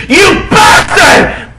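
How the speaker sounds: slow, high furious yell; angry shout. Acted. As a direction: scream it at him, furious. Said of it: "I shouted angrily".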